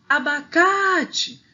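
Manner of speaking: ABACATE drawn out, CA high and loud; calling the word. Shown as a demonstration. Teacher asking for the strong syllable, calling abaCAAte. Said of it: "O K".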